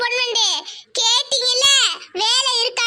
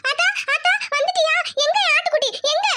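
put-on high-pitched eager child; anxious little girl; 6 semitones higher than usual. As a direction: pester mother in a high child voice. Child eagerly asks mother for lamb. (ஆவலான சிறுமியின் உயர்ந்த குரல்)

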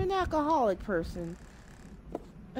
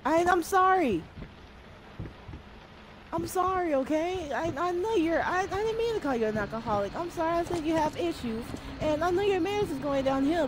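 scared apology; 6 semitones higher than usual. Real, not acted. Apologizing to the ghost in fear.

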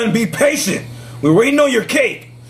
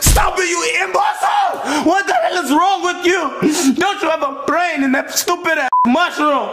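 high furious yell; angry shouting; 6 semitones higher than usual. Acted. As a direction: scream at him. Yelling at Toad.

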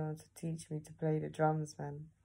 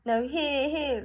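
higher, pushy instructor imitation; mock bossy teacher; 8 semitones higher, 4 dB louder, slower than usual. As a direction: mimic the rough guitar teacher. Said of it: "And it's like".

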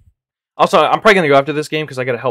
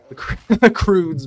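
higher, drawn-out words through a laugh; 6 semitones higher than usual. Real, not acted. Streamer catching own slip in the movie title, laughing. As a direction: break into a laugh mid-title.

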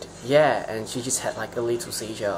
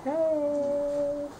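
gentle high voice; much slower than usual. Real, not acted. Greeting sick sister tenderly.

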